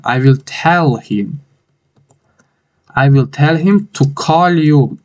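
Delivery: very slow, word by word; slow model sentence. Shown as a demonstration. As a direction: build the sentence slowly for students. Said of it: "teacher builds sentence slowly". (آهسته، کلمه به کلمه)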